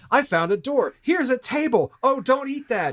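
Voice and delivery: high, hammy horror movie lines; bad horror dialogue. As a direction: overact cheap horror panic. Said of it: "that kind of Saw level of dialogue".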